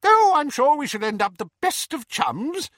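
high-pitched, chirpy optimism; bright. A narrator's reading. Diggle cheerfully reassuring Harry on his way out, bright. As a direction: chirp it loudly in a high, jolly voice. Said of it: "said Diggle brightly".

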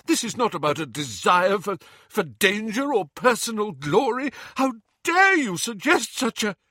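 rising fury cut off mid-word; furious. Acted. Lupin defends himself against Harry, climbing in fury.

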